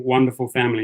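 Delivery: normal talking voice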